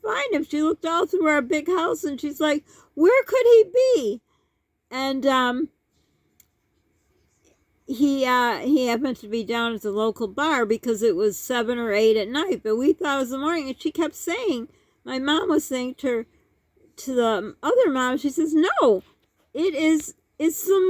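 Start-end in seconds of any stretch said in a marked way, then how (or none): 2.9-4.2 imitated bewilderment, high, loud and slow; baffled wife. Wife baffled at missing husband. 18.5-20.8 insistent mom. Retold or imitated. Mom insists it's morning.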